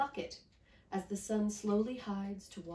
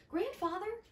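crying out; 12 semitones higher than usual. Acted. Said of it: "Miyuki cried".

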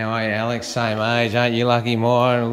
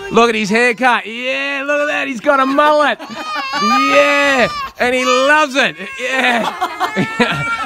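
high, loud, delighted hollering; excited cheering; 12 semitones higher, 4 dB louder, slower than usual. Real, not acted. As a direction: whoop it up over someone's haircut. Showing off the boy's mullet, cheering.

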